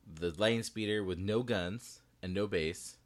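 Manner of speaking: ordinary talk, nothing marked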